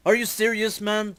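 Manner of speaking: rising to a shriek at the last words; very upset. Acted. Jazz yells at E-Man after being turned into a bird. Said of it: "Jazz is very upset".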